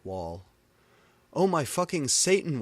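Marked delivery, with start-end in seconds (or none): 1.3-2.6 scream. A narrator's reading. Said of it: "I screamed as I read it".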